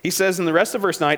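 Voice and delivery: usual speaking voice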